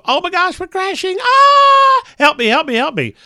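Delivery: high screaming panic, pitch way up; mock panic. Pilot mocking a panicked crash scream. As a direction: ham up a pilot in full panic. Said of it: "He didn't go".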